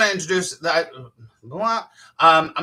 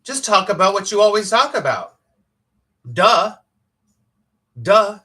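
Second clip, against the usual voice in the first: loud, higher-pitched "duh" exasperation; mock-exasperated inner voice, pitched up; retold obvious answer; 5 semitones higher, 7 dB louder than usual. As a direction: state the blindingly obvious, loudly. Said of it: "immediately was like".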